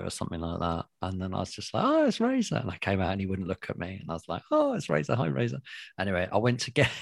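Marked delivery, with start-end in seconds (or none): 1.8-2.6 very high-pitched retold exclamation; mock awe. Acting out seeing feared opponent. 4.5-5.6 high little voice, dropping toward the end; mock timid greeting. Acting out timid greeting to opponent.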